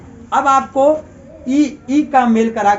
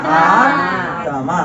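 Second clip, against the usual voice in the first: very slow, stretched vowels; long drawn आत्मा; 5 semitones lower, much slower than usual. Shown as a demonstration. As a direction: stretch आत्मा out slowly for the class. Teacher drawing out word.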